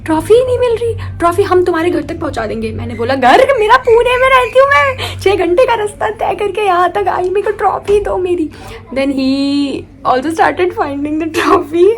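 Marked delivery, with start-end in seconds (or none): 0.0-2.7 soothing adult. Retold or imitated. Judge consoling her. 3.1-8.5 high tearful protest. Retold or imitated. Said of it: "रोते हुए".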